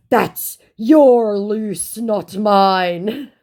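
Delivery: high, loud, very drawn-out; slow exaggerated scammer taunt; mock scammer. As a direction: stretch it smugly as the scammer. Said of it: "said my scammer".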